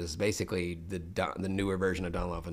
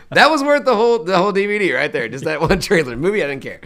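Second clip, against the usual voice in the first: excited, laughing; 10 semitones higher, 14 dB louder than usual. Retold or imitated. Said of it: "I was like".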